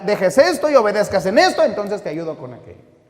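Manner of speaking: loud, high, ringing preacher's voice; full-force preaching. Acted. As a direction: preach it out with fire. (predicación a todo pulmón, fuerte y aguda)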